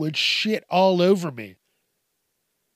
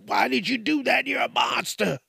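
outraged crowd yell; 9 semitones higher than usual. Retold or imitated. Bystanders shouting at the bouncer, high outraged yell. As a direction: shriek it like a shocked bystander.

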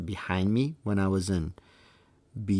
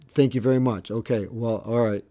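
mock-polite, raised and louder thanks; sarcastic; 4 semitones higher, 5 dB louder than usual. Assistant coach brushed off by the umpire, sarcastic. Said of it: "very sarcastically".

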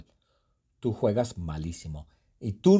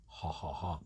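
whispered laugh; 5 semitones lower, 11 dB quieter than usual. Real, not acted. Laughs after the nose joke.

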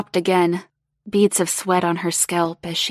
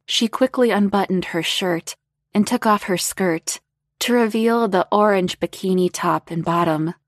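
ordinary delivery, nothing marked

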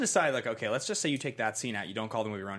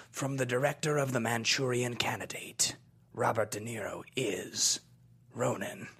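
nineties trailer voice. Acted. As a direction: whisper it like a movie trailer. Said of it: "my mid nineties trailer voice".

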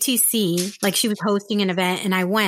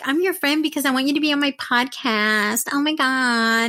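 exaggerated pleading, stretched ending; mock clingy friend; 3 semitones higher than usual. Podcaster mocking friends who want guests, hammed-up. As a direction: parody a gushing user-friend.

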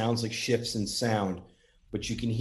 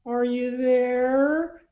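very high, loud, slow sing-out, mocking imitation; mock patronizing call to spirits; condescending; 5 dB louder, much slower than usual. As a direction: exaggerate a condescending ghost hunter, stretching each word high and loud. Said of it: "he talks so condescending to them".

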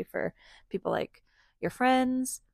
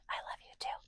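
whispering; 15 dB quieter, much faster than usual. Pretend. Whispered love exchange with child.

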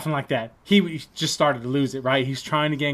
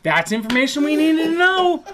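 high, loud comic protest; exasperated mock shout; 14 semitones higher, 8 dB louder than usual. Classmates scolding friend high on shrooms, exasperated shouting. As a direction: yell it in acted exasperation.

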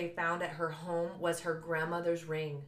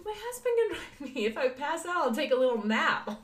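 playful protest; 8 semitones higher, 4 dB louder than usual. Retold or imitated. Retold joking reply to paramedics.

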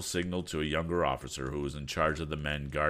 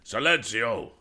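quick angry cry; 6 semitones higher, 7 dB louder, slower than usual. A narrator's reading. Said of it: "cried Lieutenant Drascalo quickly".

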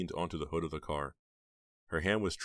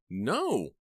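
sharp no; 8 semitones higher, 5 dB louder than usual. A narrator's reading. Heather's sharp refusal.

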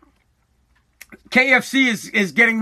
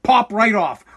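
ordinary talk, nothing marked

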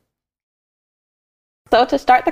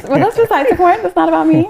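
playful; 11 semitones higher, 5 dB louder than usual. Real, not acted. Joking deflection about herself.

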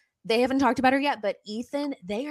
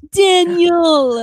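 loud high call across room; 9 semitones higher, 13 dB louder than usual. Real, not acted. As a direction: call his name out loud. Calling out to partner.

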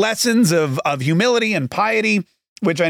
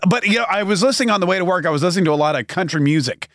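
usual speaking voice

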